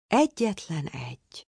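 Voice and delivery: usual speaking voice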